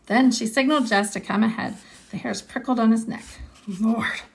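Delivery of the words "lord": ordinary delivery, nothing marked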